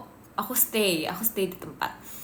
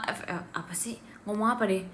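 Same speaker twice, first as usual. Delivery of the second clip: whispered aside; 7 dB quieter than usual. Real, not acted. Streamer whispering a quick aside to herself off mic. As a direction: mutter it under your breath to yourself.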